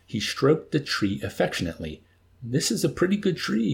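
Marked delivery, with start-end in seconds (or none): 2.4-3.7 higher-pitched, proudly appreciative; admiring. A narrator's reading. Milo to Yossarian up in the tree, admiringly. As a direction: admire it like a grateful owner. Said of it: "he observed admiringly with proprietary gratitude".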